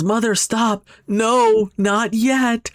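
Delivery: high, loud desperate yell; acted yell. Narumi yells at her mother to stop, loud and high. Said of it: "Narumi yells".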